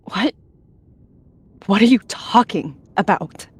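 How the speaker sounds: snarled. A narrator's reading. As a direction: snap back in disbelief. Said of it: "She snarled".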